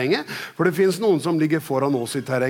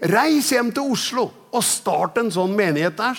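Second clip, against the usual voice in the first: higher-pitched, forceful order; retold command; 6 semitones higher than usual. Preacher quoting the Spirit's order to start a church, raised commanding voice. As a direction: issue it as a quoted order.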